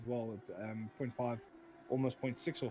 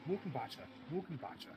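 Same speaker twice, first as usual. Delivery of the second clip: hammed-up German accent on the surname; mock German pronunciation of Mulkenbacher; put-on German accent. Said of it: "I had to put an accent on".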